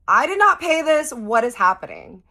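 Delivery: frantic, can't believe her eyes; alarmed disbelief. Retold or imitated. Panic over mystery debt payments.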